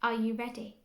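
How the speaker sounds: ordinary talk, nothing marked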